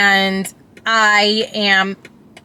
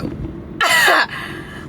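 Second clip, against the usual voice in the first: laugh; 10 semitones higher, 3 dB quieter than usual. Real, not acted. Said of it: "So on my emotional side".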